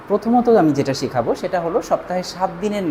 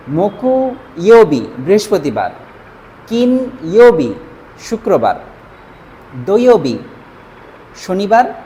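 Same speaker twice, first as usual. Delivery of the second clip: each Japanese day name said louder and set apart; teacher reading out the days of the week louder; reciting for learners; 4 dB louder than usual. Shown as a demonstration. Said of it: "আগে পুরোটা একবার পড়ে নিব".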